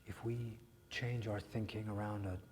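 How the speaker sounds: hushed, gentle reassurance; soft whisper. Acted. Therapist quietly reaching out to Jack, hushed whisper. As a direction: whisper it close, like comfort.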